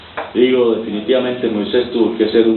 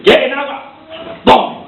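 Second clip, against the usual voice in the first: high, louder furious bark; angry shout; 13 semitones higher, 3 dB louder than usual. Acted. Moses losing his temper at the people, angry shout. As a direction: snap it out striking the rock. Moses strikes the rock in anger.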